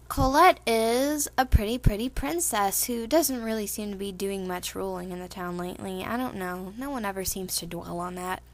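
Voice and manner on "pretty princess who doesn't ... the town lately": normal talking voice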